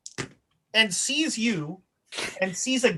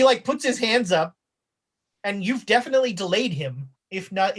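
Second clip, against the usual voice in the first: ordinary talk, nothing marked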